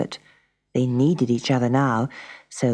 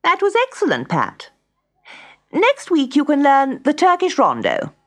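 high, louder teacher's praise; pleased music teacher; 12 semitones higher, 4 dB louder than usual. A narrator's reading. Music teacher praising Pat after her lesson, pleased and high. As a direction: praise a pupil in a high, raised voice.

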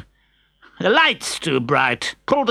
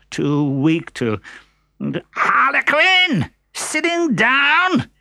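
angry boss; 7 semitones higher than usual. Acted. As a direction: berate the servant furiously. Pantalone scolds and beats servant.

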